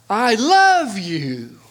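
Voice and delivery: "love" stretched out high and loud; stress on LOVE. Shown as a demonstration. Preacher recalls speech-class lesson, stressing "love". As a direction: lean long and hard on "love". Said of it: "emphasize each word differently".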